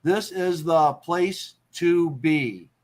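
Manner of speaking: "This is the place to be" slow, evenly spaced beats; syllable by syllable. Shown as a demonstration. Teacher building a haiku line, "This is the place to be" syllable by syllable. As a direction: count out "This is the place to be" word by word.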